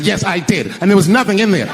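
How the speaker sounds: high indignant imitation of a wife; retold angry wife. Wife confronting her husband about the box, mock angry. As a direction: snap it back, mock mad. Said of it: "she was mad at me".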